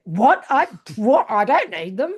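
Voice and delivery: imitating an indignant partner; taken-aback protest. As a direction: protest like you've been insulted. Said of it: "And Ron's like".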